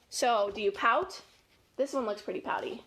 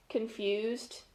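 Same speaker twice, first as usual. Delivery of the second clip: usual speaking voice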